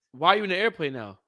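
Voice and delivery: usual speaking voice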